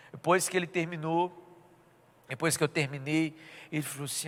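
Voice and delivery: normal talking voice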